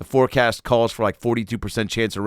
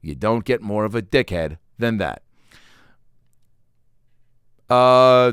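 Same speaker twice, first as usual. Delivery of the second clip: usual speaking voice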